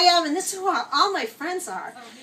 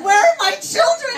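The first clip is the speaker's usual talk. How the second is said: high, louder wail of a hammed-up upset mother; mock distraught demand; 10 semitones higher, 5 dB louder than usual. Mother asking after her missing children, mock distraught. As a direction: play up the outraged mother, pitched high.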